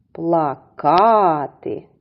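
"Плакати" slowly, with long stressed -ка-; stress on second syllable. Shown as a demonstration. Teacher showing children the stress, long second syllable. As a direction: stretch KA in "Плакати": pla-KAAA-ty. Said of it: "наголос в цім слові припадає на другий".

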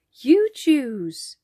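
put-on high, loud, merry child voices; laughing children. A narrator's reading. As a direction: call it out brightly as delighted children. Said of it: "laughed Katie and Jack".

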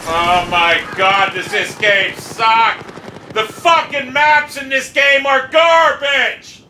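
slow exasperation rising to a high shout; angry rant. Real, not acted. Gamer condemning the game's maps, furious shouting. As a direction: stretch each word, building to a real yell.

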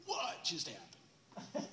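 laughing disbelief. Retold or imitated. Jessica freaking out.

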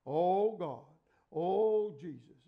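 loud, drawn-out mock pleading prayer; mocking rote prayer. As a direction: ham up an empty repetitive prayer. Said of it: "Some people pray the same prayer every time".

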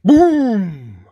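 loud, high, stretched-out boom; sudden big storybook crash; thunderous boom. A narrator's reading. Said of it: "Such a thunderous sound".

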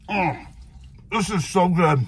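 chewing while talking; muffled between bites; mouth full of burger. Real, not acted. Seen 2: Takes big burger bite around 2.2-3.0s, talks after with full mouth.

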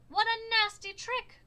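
high, loud indignant cry; all shout it together; cried out. A narrator's reading. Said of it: "cried the animals".

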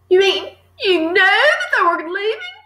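put-on high, incredulous child's question; shocked cry. A narrator's reading. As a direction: cry out in disbelief at your teacher. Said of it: "Matilda cried".

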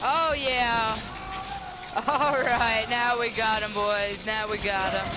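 loud, high, cheering victory; triumphant shout. Real, not acted. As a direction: shout it like you've just won. Player wins the fight.

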